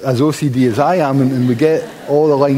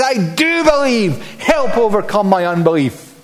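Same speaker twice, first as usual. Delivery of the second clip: desperate shriek; 6 semitones higher than usual. Retold or imitated. Father shrieks to Jesus for his son, desperate and pitched up. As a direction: cry it out like a panicked father, much higher. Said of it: "So the father is shrieking".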